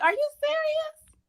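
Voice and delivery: high, slow mock disbelief; mock-shocked are you serious; incredulous. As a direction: hit it high and stretched out in disbelief. Her shocked reply to ex retold.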